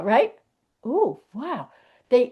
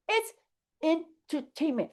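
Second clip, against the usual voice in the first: much softer, let the air out of it; deflated repeat; 2 semitones higher, 9 dB quieter than usual. Real, not acted. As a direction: say it again, giving up. Deflated repeat after outburst.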